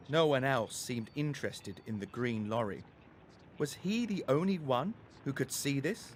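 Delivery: ordinary delivery, nothing marked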